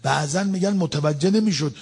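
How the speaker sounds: usual speaking voice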